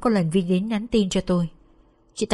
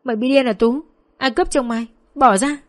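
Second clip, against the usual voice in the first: higher, louder indignant cry; yelling back; 5 semitones higher, 5 dB louder than usual. Acted. Vân, beaten and bewildered, screams back at Tú, indignant yelling. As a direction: yell in protest at the attacker. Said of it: "nên cũng gào lên".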